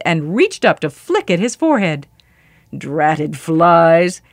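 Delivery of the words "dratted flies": low, grumbling giant voice; deep giant's irritated mutter. A narrator's reading. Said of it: "he muttered".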